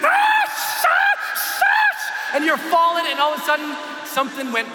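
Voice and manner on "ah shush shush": high scream broken by rushing-wind hiss; scream, then imitate the roaring wind; acted shriek with whooshing. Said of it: "'cause I'm screaming".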